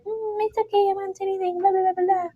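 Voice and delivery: high, soft mock-innocent voice; high, quiet faux-innocent promise; sly villain. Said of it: "she's like".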